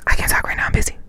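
imitating a hushed phone brush-off; furtive whisper. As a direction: whisper it like you can't be overheard. Boyfriend's furtive phone answer, imitated.

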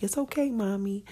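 a hint of a tiny child saying it gently; baby's comforting voice. Mother voicing her baby's touch on her face as a hint of a small child.

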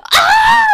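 very high, piercing scream; imitated audience scream. Imitating everybody in the theaters, full scream. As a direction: scream like a thrilled movie crowd. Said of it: "everybody in the theaters was like".

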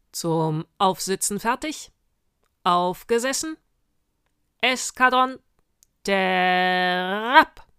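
loud military calls, stretched out; cavalry command. Acted. Sergeant ordering witches to mount, loud drawn-out commands. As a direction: shout each order across the squadron.

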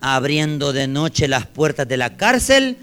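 loud, slow Bible reading; scripture proclamation. Real, not acted. Preacher reading the angel's command aloud, solemn loud proclamation. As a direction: proclaim each phrase to the whole church.

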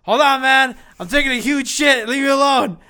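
high, loud shout through a door; yelling through door. Retold or imitated. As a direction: yell it from inside the stall. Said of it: "And then I'm like".

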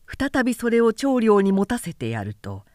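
ordinary delivery

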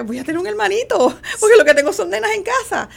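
excited son. Retold or imitated. Son excited about baby brother.

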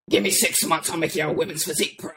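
coach's raspy promise to a lifter, impersonated; impersonating the coach. Retold or imitated. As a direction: rasp it out like the confident coach. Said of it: "I cannot talk without impersonating him".

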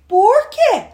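put-on high, loud childish question; pestering little girl. Little girl pesters older sister.

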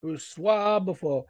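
slow, drawn-out French-style name call; French announcer call. Retold or imitated. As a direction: stretch it like a French ring announcer. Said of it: "He always does that".

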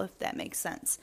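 soft, unvoiced trailing off; trailing whisper. Real, not acted. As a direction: breathe it out as an afterthought. Trails off after long thought.

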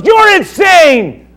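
high, slow, furious shouting; outraged shout. Acted. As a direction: bellow it like a scandalised governor. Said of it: "He goes".

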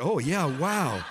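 slow chuckling wow; a sheepish laugh at his own number; laughing to himself. Retold or imitated. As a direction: laugh it out, stunned by the figure. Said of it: "I thought".

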